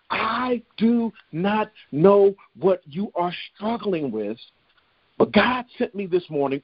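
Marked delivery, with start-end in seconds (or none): 0.1-4.4 slow, every word set apart; emphatic slowdown. Real, not acted. Preacher admitting not knowing listeners' struggles, emphatic slow delivery. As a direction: spell it out word by word, slowly.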